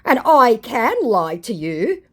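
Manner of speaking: slow and proud, like a clumsy liar; mock scammer voice. Scammer boasting he can lie, slow mock voice. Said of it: "he said".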